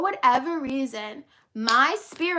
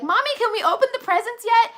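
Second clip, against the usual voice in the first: put-on higher, louder little-kid plea; eager child; 10 semitones higher, 6 dB louder than usual. Speaker as a child asking mom on Christmas morning, eager. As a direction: beg like an impatient kid. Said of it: "waking up ... as a child".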